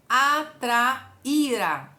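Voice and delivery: atraíra drawn out very slowly, syllable by syllable, falling at the end; slow stretched word. Shown as a demonstration. Teacher drawing out atraíra slowly for students, syllable by syllable. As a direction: say atraíra very slowly so each syllable stands apart, the í clearly held.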